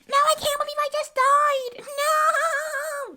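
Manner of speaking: very high-pitched, screechy wail; mock a streamer's piercing squeal; screechy VTuber. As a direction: screech it, stretching the last word out. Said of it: "it's just high-pitched, screechy".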